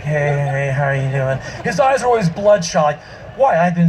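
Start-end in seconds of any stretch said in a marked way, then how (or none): 0.0-1.4 low, worn-out sleepless greeting; tired mumbling hello; exhausted friend. Retold or imitated. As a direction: drag it out like you haven't slept in years. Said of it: "looking like he hasn't slept in nine years".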